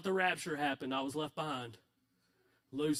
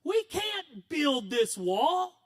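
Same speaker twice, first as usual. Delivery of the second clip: discouraged whine; 8 semitones higher, 6 dB louder, much slower than usual. Acted. Discouraged builders complaining.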